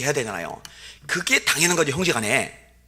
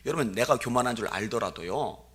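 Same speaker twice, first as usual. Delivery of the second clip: ordinary delivery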